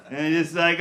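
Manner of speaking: usual speaking voice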